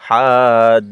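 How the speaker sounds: drawn-out recitation of হাজ; long madd. Shown as a demonstration. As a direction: stretch হাজ for four counts. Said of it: "উপরে মোটা চিহ্ন".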